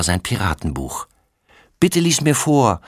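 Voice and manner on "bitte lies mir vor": put-on high pleading child; begging little boy. A narrator's reading. Little brother begs his sister to read, high pleading. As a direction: beg like a little kid. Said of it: "bettelt er".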